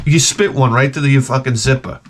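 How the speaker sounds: ordinary delivery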